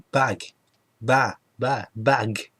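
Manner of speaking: "bag" repeated, mostly cut to "ba" without its g; dropped final consonant. Retold or imitated. Shop staff repeating "bag" to a baffled customer, final g swallowed. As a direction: imitate Scots saying "bag" as a bare "ba". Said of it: "they don't pronounce all the letter".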